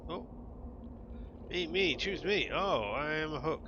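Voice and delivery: a hint of a pleading hook creature, slow in places; lightly voiced lonely hook. Voices a fishing hook character.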